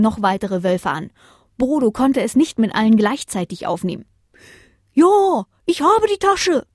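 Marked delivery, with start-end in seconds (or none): 4.9-6.7 high, loud owl reply; calling out. Acted. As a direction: shout down the good news. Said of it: "rief die Eule Bodo zu".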